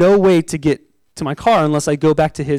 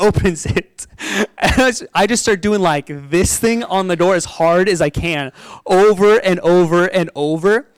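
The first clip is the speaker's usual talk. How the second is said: ordinary delivery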